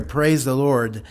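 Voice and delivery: ordinary talk, nothing marked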